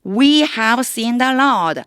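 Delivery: loud, high and slow "We have seen the Lord"; excited disciples. Acted. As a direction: announce the joyful news. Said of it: "도마에게 말했습니다".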